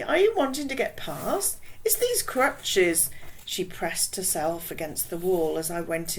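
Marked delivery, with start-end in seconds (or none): 0.0-3.1 put-on West Indian accent. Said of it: "in a strong West Indian accent".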